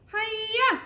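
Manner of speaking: put-on very high, loud kid's karate yell; a kid's desperate hammed-up battle cry. A narrator's reading. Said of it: "I cried".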